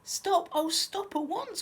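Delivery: high, loud storybook plea; urgent cry. A narrator's reading. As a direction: cry out to halt the elephant. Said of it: "cried Fieldy".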